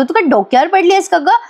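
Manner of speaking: a touch of mock anger in the question; mock irritated retort. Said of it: "आता अद्वैत चिडतो तिच्यावर". (हलका नक्कल केलेला चिडका टोमणा)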